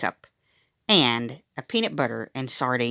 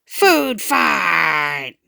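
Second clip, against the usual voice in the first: battle cry; 2 semitones higher, 5 dB louder than usual. A narrator's reading. Kid launches food fight.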